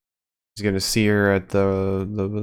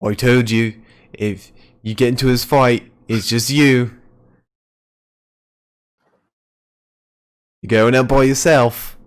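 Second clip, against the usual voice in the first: attempted Scottish accent; 2 semitones higher, 6 dB louder than usual. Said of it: "That's probably not his accent at all".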